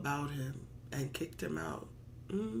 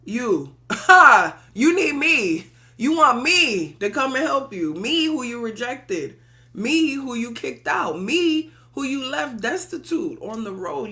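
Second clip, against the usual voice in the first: loud, high, scornful taunting; loud, mocking refusal from the rejected Oluwapopo; 6 semitones higher, 11 dB louder than usual. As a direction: sneer it down at them, loud and high. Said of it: "looked down his nose and said".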